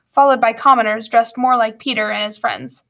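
usual speaking voice

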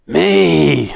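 high, drawn-out owl cry; screeching owl. A narrator's reading. Barn owl answering Joe at the window, screeching. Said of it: "screeched the owl".

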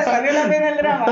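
loud, high, laughing delivery. Real, not acted. Guest joking with host about her past drama, laughing. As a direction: say it through a big laugh.

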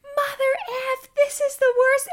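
very high, loud retold scream; hammed-up labor shriek; screaming profanities. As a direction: curse through a contraction.

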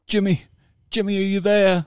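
high-pitched hammed-up calling out; cheesy film dialogue. Mocking soldiers calling to a shot comrade, hammed-up cheesy cry. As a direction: call out like a soldier in a corny war film. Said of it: "cheesy lines".

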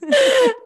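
high loud laugh; burst of laughter. Real, not acted. Laughing at her slip.

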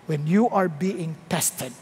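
ordinary talk

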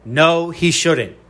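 drawn out, every word weighted; slow it right down so it lands; slow emphatic no. Real, not acted. Podcaster rejecting a pundit's MVP pick, slow and emphatic. Repeats rebuttal slowly for emphasis.

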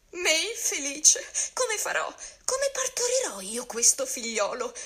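high, panicked wailing lament; desperate lament. A narrator's reading. Calandrino despairs over having to give birth, wailing high. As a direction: wail in comic despair.

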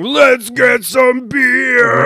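high, slow, drawn-out holler; party shout. Acted. Undead Bob rallies his buddies, party shout. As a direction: whoop it out, stretching the last word.

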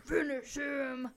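loud, slow, drawn-out command; mock game announcer. Podcast host capping the shouting bit, slow loud announcer parody. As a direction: boom it like a fight-game announcer.

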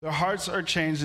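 ordinary talk, nothing marked

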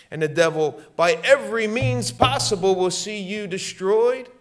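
high, loud warning about the devil; fiery preaching. Real, not acted. Preacher warning the congregation about the devil, fiery and loud. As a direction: thunder it from the pulpit.

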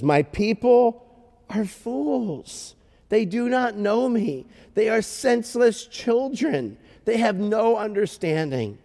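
high, drawn-out dramatic lament; God lamenting. Acted. Preacher voicing God about his foolish people, lamenting.

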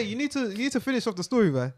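ordinary talk

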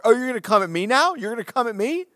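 high, loud, confrontational yelling; retold angry yelling. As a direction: yell it back like you think he's picking a fight. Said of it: "And she starts yelling louder".

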